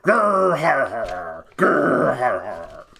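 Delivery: loud growling bear laugh, grr har har; a bear roaring with laughter; bearish laugh. Acted. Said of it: "a very bearish laugh".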